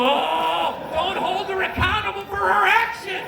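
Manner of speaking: high, loud mocking whine of other guys. Mocking men who excuse their wives, high whiny voice. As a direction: sneer it like a spineless husband. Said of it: "I hate when guys go".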